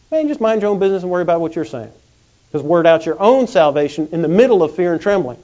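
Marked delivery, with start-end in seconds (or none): none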